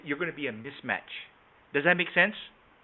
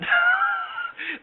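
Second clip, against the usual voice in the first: burst of laughter; 4 dB louder than usual. Real, not acted. Trainer laughs at own joke.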